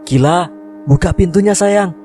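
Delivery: high-pitched anxious calling; shouting. A narrator's reading. Father outside the locked room, high urgent call. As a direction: call through a door in a high voice. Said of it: "teriak ayah dari luar".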